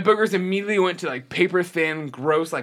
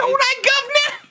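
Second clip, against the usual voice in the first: a cartoonish East London caricature, shouted; mock Cockney accent. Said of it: "Why the Cockney accent".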